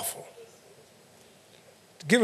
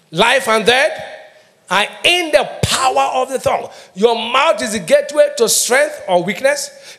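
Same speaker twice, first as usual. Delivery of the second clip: raised, emphatic pulpit delivery; forceful preaching; 5 semitones higher, 3 dB louder, slower than usual. Real, not acted. Preacher drilling a warning into the congregation, forceful preaching. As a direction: preach it hard, landing every key word. Preacher hammers home a point.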